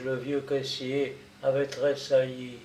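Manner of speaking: ordinary delivery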